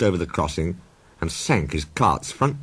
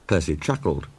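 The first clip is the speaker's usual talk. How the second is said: plain reading voice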